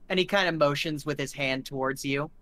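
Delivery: usual speaking voice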